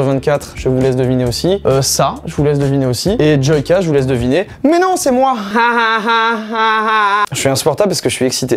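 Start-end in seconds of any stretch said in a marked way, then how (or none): 4.6-5.3 squeaky joke voice. Pretend. Jokes he is the reference. 5.4-7.3 high-pitched giggle; a burst of squeaky laughter. Real, not acted. Laughs after the joke.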